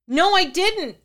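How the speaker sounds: high, loud, snapped denial; girl hits back at the priest in anger; angry retort. Acted. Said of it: "Vicky shot in anger".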